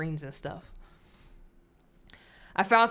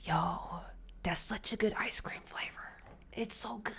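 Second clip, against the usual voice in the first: hushed, softer whisper; 9 dB quieter than usual. Real, not acted. Wife whispering to chat about her favorite ice cream. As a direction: whisper it like a secret treat. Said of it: "Why am I whispering?".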